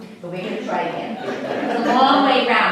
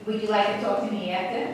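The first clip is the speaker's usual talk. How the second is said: normal talking voice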